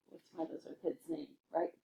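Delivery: usual speaking voice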